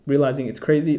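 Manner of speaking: ordinary talk, nothing marked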